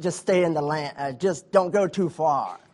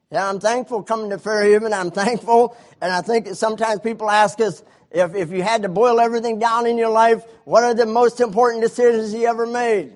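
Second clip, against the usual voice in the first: louder, higher preaching build; preaching at full force; 7 semitones higher, 8 dB louder than usual. Acted. Preacher stirring the church to full force. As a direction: lift the congregation with fervour.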